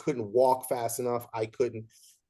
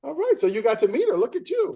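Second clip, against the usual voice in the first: high, bright look-at-you; host delighted by guest's news, high and lively; excited teasing; 11 semitones higher, 5 dB louder, faster than usual. Real, not acted. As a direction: cheer her on playfully.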